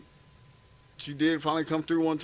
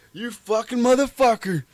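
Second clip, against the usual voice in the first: loud, raised-pitch retold cursing; mock angry sergeant; 9 semitones higher, 8 dB louder than usual. Storyteller imitating sergeant who spots him, mock angry shout. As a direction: cuss him out in imitation, loudly.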